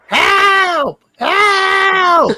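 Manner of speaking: high, loud cry for help; old lady screaming. Retold or imitated. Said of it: "she is on the front porch just screaming".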